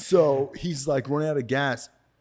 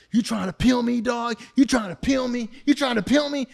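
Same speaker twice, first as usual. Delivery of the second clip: angry yell; 12 semitones higher, 5 dB louder than usual. Retold or imitated. Angry dealer confronting him.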